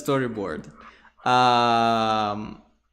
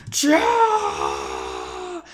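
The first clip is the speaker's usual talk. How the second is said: deep, shouted monster roar; creature roar; 12 semitones lower than usual. Pretend. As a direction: bellow it from the chest like a risen beast. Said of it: "and then goes".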